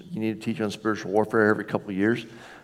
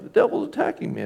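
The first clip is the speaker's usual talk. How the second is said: whiny churchgoer; 10 semitones higher than usual. Retold or imitated. Mimicking a complaining churchgoer.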